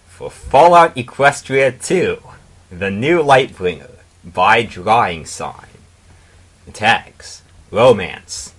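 grand title card read; title announcement. Acted. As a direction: present the title like a show opener.

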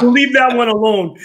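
said through a laugh; amused. Real, not acted. Host dropping the Hooters joke, laughing. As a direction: chuckle while waving the subject away.

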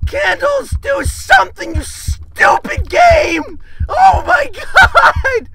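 high, drawn-out exasperated cry; frantic frustration. Real, not acted. Player stuck and scolding the game, frantic frustration. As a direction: wail at the stupid game.

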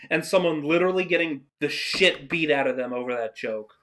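ordinary talk

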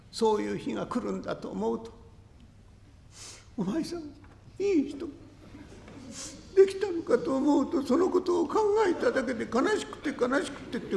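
high, wailing lament; high tearful pleading. Acted. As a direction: sob out her fear of being left. 花魁の泣き言を声色で. (花魁が泣きながら不安を訴える)

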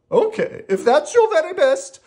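high, reluctant character voice; doubtful. Acted. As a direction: agree doubtfully, starting loud and high. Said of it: "Rocky said doubtfully".

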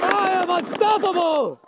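hammy boast. Real, not acted. Playful boast mid game.

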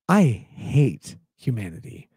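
a low, heavy, sincere confession; weary, low, bitter sincerity; sincere, low and slow. Real, not acted. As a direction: say it slowly and mean it. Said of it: "in a moment of seriousness".

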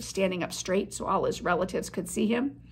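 ordinary delivery, nothing marked

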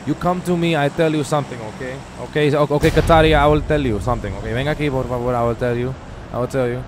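emphatic put-on Spanish accent; thick mock Spanish, insistent. Streamer telling another player something, mock Spanish accent. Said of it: "your Spanish accent".

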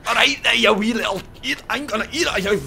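high-pitched, dire mock Scottish; squeaky put-on Scottish voice; bad Scottish accent. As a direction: ham up a terrible Scottish accent. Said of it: "That is a diabolical Scottish accent".

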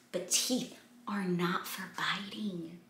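whispered rule; soft whispered refrain. Acted. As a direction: hush the rule.

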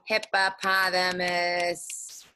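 hippopotamus chopped slowly into separate beats; broken into syllables. Shown as a demonstration. Teacher showing kids the wrong way, slow and chopped. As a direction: tap out hippopotamus one syllable at a time, the wrong way. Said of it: "No, it's all one word".

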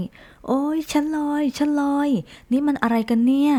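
high, slow squeal of surprise; startled cry. A narrator's reading. Rabbit floating up a tree, squealing. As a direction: shriek as you float away. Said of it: "กระต่ายร้องลั่น".

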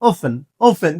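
"often" as off-en, no T; "often", F straight into "en"; standard pronunciation. Shown as a demonstration. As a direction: say "often" with a silent T. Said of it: "standard ইংলিশে often".

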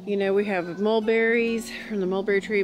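normal talking voice

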